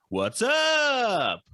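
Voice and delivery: high, loud shouted greeting; Anthony impression. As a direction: bark it out like an excited buddy. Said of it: "I'm gonna do my best Anthony impersonation".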